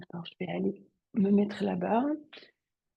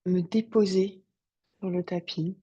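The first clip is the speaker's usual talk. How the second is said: guided meditation; 2 semitones lower, 6 dB quieter than usual. Acted. Yoga teacher guiding students to settle on the mat, meditative calm. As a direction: settle them calmly into stillness, unhurried.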